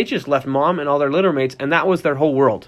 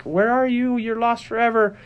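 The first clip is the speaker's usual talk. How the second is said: high, wailing pup at the door; crying puppy; 8 semitones higher than usual. Retold or imitated. Dog crying under the bathroom door for its owner, high mock wail. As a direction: play a pup whimpering for its owner, much higher.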